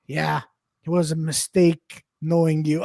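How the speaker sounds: low, quiet, slow deadpan impression; remorseless tough guy. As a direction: say it low and cold, with a pause. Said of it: "and he, he goes".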